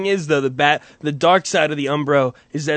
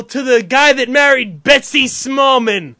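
mock proclamation; 8 semitones higher, 6 dB louder than usual. Addressing Betsy's husband loudly.

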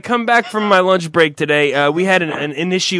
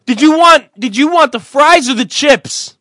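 loud frantic waiter parody; mocking forgetful waiter; 6 semitones higher, 4 dB louder than usual. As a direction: play the flustered waiter loudly.

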